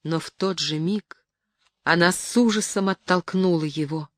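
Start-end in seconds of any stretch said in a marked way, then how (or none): none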